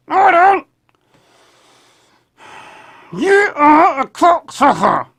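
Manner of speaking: high, loud puppet voice; squeaky puppet denial. Acted. Puppet insults owner.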